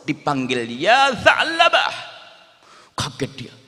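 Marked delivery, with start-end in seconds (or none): 0.8-1.9 long, loud drawn-out call; drawn-out hail to a boy hiding up the hill. Acted. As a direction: call his name across the hills, slowly.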